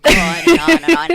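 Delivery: fast, high, loud cheering on; excited hype. Real, not acted. Reporter loudly and quickly urging the host to keep complimenting her. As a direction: egg her on, giddy and quick.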